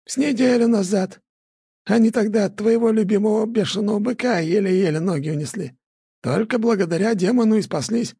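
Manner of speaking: high, creaky village elder; old elder voice. A narrator's reading. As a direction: voice the old man gently. Elder continues. (голос старика)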